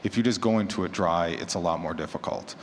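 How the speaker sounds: ordinary talk, nothing marked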